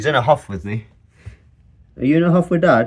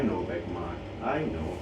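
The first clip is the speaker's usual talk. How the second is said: soft soothing; 7 semitones lower, 11 dB quieter than usual. Real, not acted. Soothing snake during eye cap.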